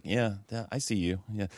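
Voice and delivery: soft, discreet murmur to a fellow fan. Retold or imitated. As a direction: imitate a subdued, knowing aside. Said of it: "low profile eye contact".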